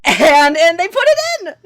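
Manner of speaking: high, loud, gleeful burst; excited punchline. Real, not acted. Co-host revealing casting trivia to friends, loud excitement. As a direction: blurt out the kicker at full excitement.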